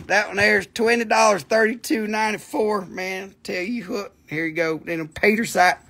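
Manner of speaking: usual speaking voice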